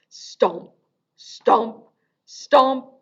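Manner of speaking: stomping dinosaur. Acted.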